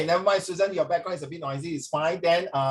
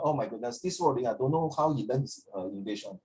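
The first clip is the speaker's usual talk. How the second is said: imitating scornful strangers; 4 semitones lower, 5 dB quieter than usual. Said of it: "people would know that these strangers".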